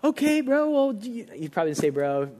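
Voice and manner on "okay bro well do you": much higher, awkwardly upbeat pastor; trying hard to sound friendly; forced cheer. Retold or imitated. Pastor voiced on phone call.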